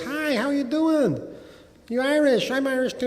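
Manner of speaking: put-on a bar-stool Yank claiming to be Irish in an American accent; mock Yank voice. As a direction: imitate a chummy American tourist in a New York bar. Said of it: "some guy goes".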